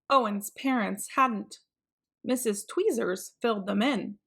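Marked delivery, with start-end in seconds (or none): none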